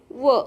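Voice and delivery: व as an isolated "vuh"; phonic V sound. Shown as a demonstration. Teacher showing the sound of V, व as "vuh". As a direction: sound out व, not the letter name. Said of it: "व्हीचा उच्चार होतो".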